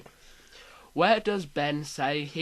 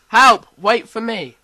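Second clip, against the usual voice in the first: shouting; 6 semitones higher, 17 dB louder, slower than usual. Acted. Reading a shouted quiz quote.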